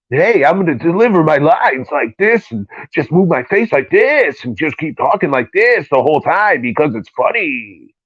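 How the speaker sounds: high, put-on goofy comic voice; mocking the actor's voice. Mocking the lead actor's stupid delivery in the movie, high goofy put-on voice. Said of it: "him talking in that stupid fucking voice".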